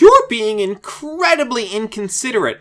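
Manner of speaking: high, indignant scolding voice. Retold or imitated. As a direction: tell her off, outraged. Scolding Velma for sneezing.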